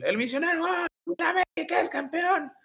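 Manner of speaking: high, excited goal call; breakneck goal commentary. Retold or imitated. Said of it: "Es el momento más Raúl Sarmiento".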